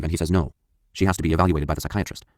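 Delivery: usual speaking voice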